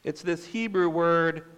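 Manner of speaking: ordinary delivery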